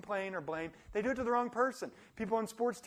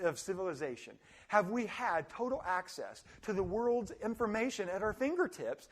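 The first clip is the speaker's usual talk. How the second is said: ordinary talk, nothing marked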